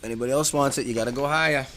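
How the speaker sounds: loud auctioneer call to the room; calling out bids. Real, not acted.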